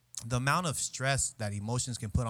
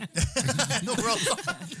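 much higher, slow, cracking up; laughing mock echo; 11 semitones higher, 5 dB louder, much slower than usual. Real, not acted. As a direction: repeat her line teasingly. Teasing echo of friend.

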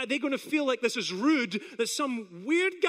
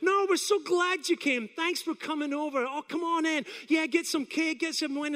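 gushing hosts, pitched way up; welcoming neighbours; 6 semitones higher than usual. Retold or imitated. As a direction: sing out a delighted invitation in. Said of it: "And they just went".

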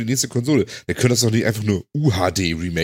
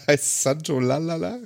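laughing; 5 semitones higher, slower than usual. Pretend. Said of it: "ob jetzt [name] lallt".

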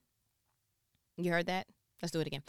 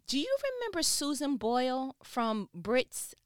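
ordinary talk, nothing marked

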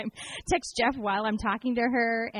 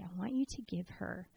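quiet, deliberate voice of the Holy Spirit; hushed divine prompting; 3 semitones lower, 10 dB quieter, slower than usual. Retold or imitated. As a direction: softly voice God's instruction, slowing right down on the amount. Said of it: "felt the Holy Spirit say".

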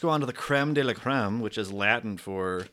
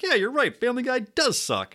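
mock agreement; 12 semitones higher, 4 dB louder than usual. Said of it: "Then I was like".

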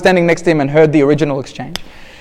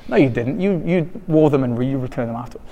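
low, softened rebuttal; understated knowing correction, dropping away; quiet contradiction; 4 semitones lower, 8 dB quieter than usual. Acted. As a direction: answer the denial under your breath. Skeptical answer to the acted denial.

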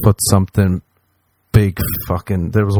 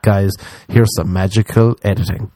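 usual speaking voice

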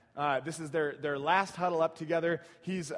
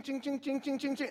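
imitated chain clicking; 9 semitones higher than usual. Imitating roller coaster chain clicks.

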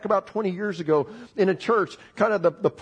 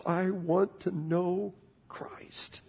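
whisper; 3 semitones higher, 6 dB quieter, much slower than usual. Real, not acted. Whispered personal prayer verse.

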